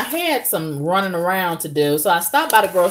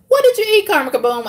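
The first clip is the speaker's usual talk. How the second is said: imagined questioner; 13 semitones higher, much faster than usual. Pretend. Audience quizzing the vlogger on food, high put-on voice. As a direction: pipe up as someone butting in.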